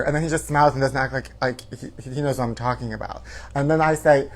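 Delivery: ordinary talk, nothing marked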